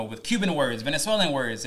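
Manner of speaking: ordinary talk, nothing marked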